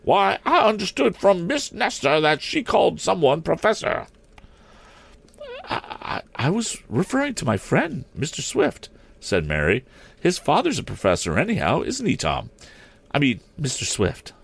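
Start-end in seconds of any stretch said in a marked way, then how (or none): 0.0-4.1 put-on high-pitched, indignant old maid principal. A narrator's reading. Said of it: "cried Miss Perkman indignantly". 5.7-8.9 put-on high, hesitant girlish voice; flustered schoolgirl. 10.2-14.3 put-on high-pitched girlish voice, correcting herself; schoolgirl. Mary's schoolgirl voice.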